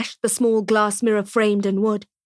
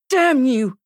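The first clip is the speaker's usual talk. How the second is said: through gritted teeth; 4 semitones higher, 4 dB louder than usual. Acted. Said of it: "this time through gritted teeth".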